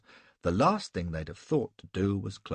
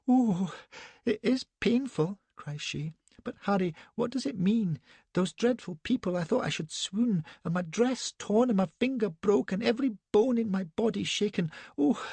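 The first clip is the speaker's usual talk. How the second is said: put-on high-pitched, petulant, breathless young lady; a shaken, indignant society wife; Elsbeth, fluttery young wife; 12 semitones higher than usual. A narrator's reading.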